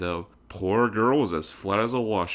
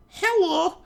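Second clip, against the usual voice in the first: very high, loud, slow prissy voice; haughty heiress greeting; 5 dB louder than usual. A narrator's reading. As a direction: greet her rival from on high. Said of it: "obviously pleased by the attention".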